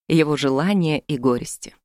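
ordinary delivery, nothing marked

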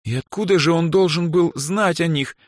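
high, ironic question. A narrator's reading. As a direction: ask with pointed irony. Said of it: "иронично спросила Эльза".

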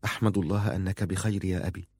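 soft, measured delivery; slow reply. A narrator's reading. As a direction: say it slowly and quietly to a father. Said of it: "قالت في بطء".

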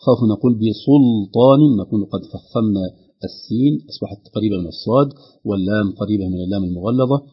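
ordinary talk

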